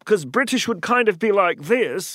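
demonstrated British accent. Shown as a demonstration. Voice coach showing what to avoid, British accent. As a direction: put on a proper British accent. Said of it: "it's not quite British".

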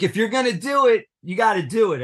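high, fast pushy producer; mock producer scold. Producers urging Eric, high fast mock scold. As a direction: push him to commit, high and fast.

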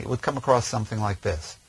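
ordinary talk, nothing marked

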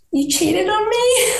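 high, slow hurt disbelief; stunned, wounded question; shocked. Retold or imitated. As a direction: let the question crack upward in shock. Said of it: "So I was just like".

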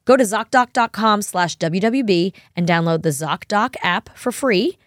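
ad read. Acted. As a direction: sell it like a podcast ad. Sponsor ad read.

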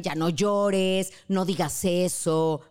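slowed, commanding; slow bossy order. Retold or imitated. Telling a grieving person to stop crying, bossy. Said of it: "No le vamos a decir".

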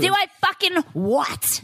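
slow fake menace; mock threatening. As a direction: pretend to threaten, slowly. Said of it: "I'm just kidding".

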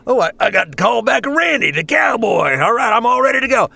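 eager actor. Retold or imitated. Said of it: "an actor will come in and is like".

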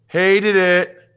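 hammed-up Texas-free In Living Color quote; TV catchphrase. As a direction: belt it out like a sketch show critic. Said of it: "You know what that's from".

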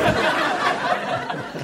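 breathy whispered enough with a laugh; whispered answer to the wife. Retold or imitated. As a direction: mouth it in a whisper. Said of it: "And you're like".

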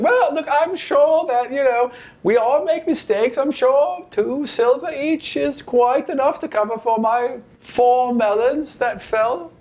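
put-on high, slow, flustered old woman; nervous old lady. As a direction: backpedal nervously, slowly. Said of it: "She's like".

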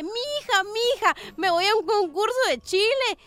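happy husband. Acted. Husband telling his wife about a contest, happy and excited. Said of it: "bien feliz, le dice".

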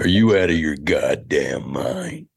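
menacing Nicholson sneer; Nicholson impression. Shown as a demonstration. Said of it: "I do Nicholson".

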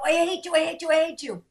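put-on very high, repetitive kid whine; mock child tantrum. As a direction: imitate a kid chanting back at a parent. Voices kid escalating at parent.

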